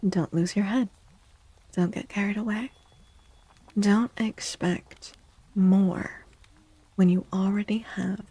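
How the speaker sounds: normal talking voice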